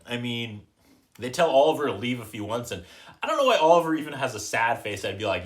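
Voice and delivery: ordinary talk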